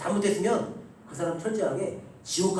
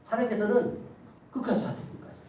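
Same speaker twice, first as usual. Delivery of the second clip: ordinary talk, nothing marked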